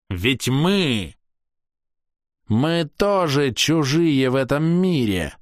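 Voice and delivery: haughty elf, high ringing voice; theatrical, scornful ranting; proud elf. Acted. Castiel continues.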